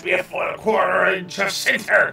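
gruff pirate captain. Acted. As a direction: growl it like an old sea captain. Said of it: "I get to do pirate voice again".

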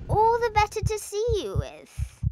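sweet false granny; wolf as granny. A narrator's reading. Wolf disguised as granny answering girl, put-on voice.

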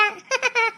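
short showy laugh; vain laugh. Acted. Girl boasting of her beauty to villagers, smug laugh. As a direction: laugh smugly at your rivals.

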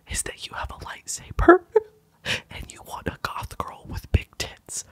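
urgent breathy whisper throughout; intense whisper. Acted. Whispering a plea to the cosplayer to stop talking. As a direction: plead in a hushed, desperate whisper.

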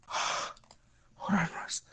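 whispered, strained plea; pained whisper. Real, not acted. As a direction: breathe the words out in pain. Whispering through pain.

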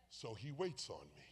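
quiet. Acted. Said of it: "And so watch this".

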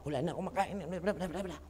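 quiet, low mimicked griping; half-hearted mock grumble, well below full voice; mocking angry patient. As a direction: mimic a complaint softly, trailing into blah-blah. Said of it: "galit pa".

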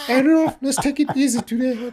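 high squeaky referee voice. Retold or imitated. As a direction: squeak it out like the ref. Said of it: "with his squeaky voice".

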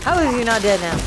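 ordinary talk, nothing marked

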